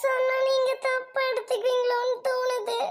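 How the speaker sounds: hesitant, sobbing confession; crying. Acted. Upset girl afraid friends will misunderstand, crying. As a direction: drag the words out through tears. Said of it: "எதுக்கு அழுகிற முதல்ல?".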